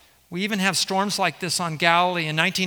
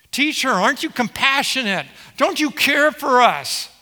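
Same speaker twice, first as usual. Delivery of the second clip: higher, louder mock cry over the storm; desperate yell; 6 semitones higher, 4 dB louder than usual. Preacher voicing disciples yelling at Jesus over the wind, raised desperate mock shout. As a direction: call out like frightened fishermen in a gale. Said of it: "they're yelling into their microphone".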